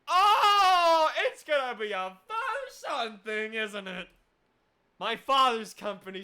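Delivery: loud, high cry of realisation; loud mock-anguished outcry; dramatic realisation. Real, not acted. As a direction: gasp it out, thrilled by the twist. Realising the father plot twist.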